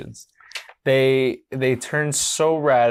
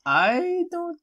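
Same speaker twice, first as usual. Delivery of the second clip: very slow, stretched, raised character voice; Candy voice; 6 semitones higher, much slower than usual. Acted. Candy unsure about the sleepover, slow drawn-out character voice. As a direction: stretch every word way out. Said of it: "That's how Candy talks".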